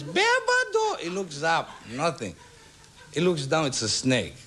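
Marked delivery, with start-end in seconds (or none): 0.0-1.0 very high falsetto taunt; piercingly high cartoon call; mysterious taunting voice. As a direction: squeal it like an unseen little heckler. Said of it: "He says".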